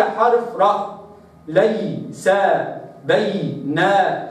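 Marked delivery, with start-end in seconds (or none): 3.0-4.3 بينهم drawn out slowly, ya held clearly; slow recitation. Shown as a demonstration. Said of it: "هكذا". (بينهم ببطء مع إظهار الياء)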